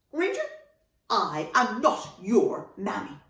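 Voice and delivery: emphatic correction, high on the name, then slow; firm mother. Acted. Mother to whingy dragon at the table, firm. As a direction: set the dragon straight word by word.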